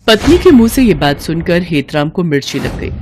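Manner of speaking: ordinary delivery, nothing marked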